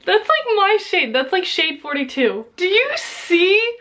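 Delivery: high, loud, drawn-out disbelief; shocked outrage. Real, not acted. Shocked at shade mismatch.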